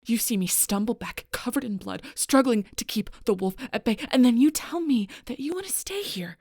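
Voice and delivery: indignant, building to high and loud; heated accusation. Acted. Confronting someone who wants to stay with monsters, increasingly heated. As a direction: pile up the charges, climbing to disbelief.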